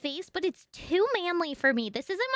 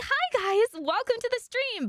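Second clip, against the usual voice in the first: high-pitched streamer voice; 8 semitones higher than usual. Shown as a demonstration. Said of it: "high-pitched crazy [name]".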